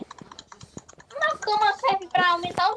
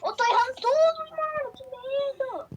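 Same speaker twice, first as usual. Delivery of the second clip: scared gamer; 8 semitones higher than usual. Real, not acted. Gamer keeps failing, scared.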